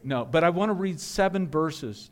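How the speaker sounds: ordinary talk, nothing marked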